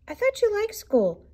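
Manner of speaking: high gentle mother voice; lightly voiced mom. A narrator's reading. Mother answering cubs.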